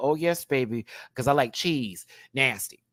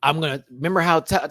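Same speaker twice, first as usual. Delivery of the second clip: usual speaking voice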